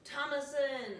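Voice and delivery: hammed-up creepy twins; terror twins. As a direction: drag it out high like accusing kids. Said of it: "Terror twins, are like".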